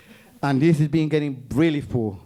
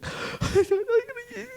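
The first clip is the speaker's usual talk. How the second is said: high sob-laugh; 4 dB quieter than usual. Retold or imitated. Crying in the hallway.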